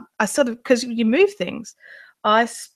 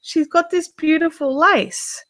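excited friend; 9 semitones higher, 4 dB louder than usual. Retold or imitated. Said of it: "Emma's come back going".

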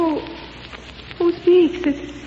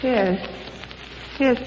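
ordinary delivery

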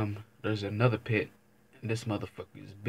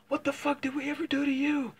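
very high indignant squawk; talking trash to dogs. Retold or imitated. Man mouthing off at two pit bulls, very high squawk. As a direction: squeal it at the dogs like a challenge. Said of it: "I was talking shit to both of these dogs".

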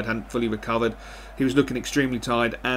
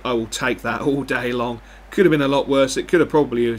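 normal talking voice

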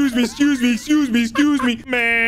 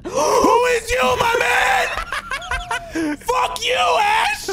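real frightened yell, very high and slow, tipping into laughter; startled scream; 14 semitones higher, much slower than usual. Real, not acted. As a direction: shriek after a jump scare, then laugh it off. Jump scare by a teammate.

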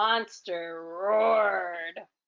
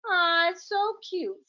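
put-on a higher, cooing little girl voice; 7 semitones higher than usual. Flower Girl seeing the fluffy monster, a higher coo. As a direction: coo it like a delighted child. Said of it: "said Flower Girl".

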